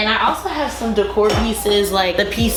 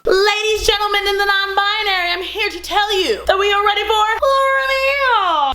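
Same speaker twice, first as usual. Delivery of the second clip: high, grand host announcement; gushing makeover reveal; 11 semitones higher than usual. Pretend. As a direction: announce it like a game show host.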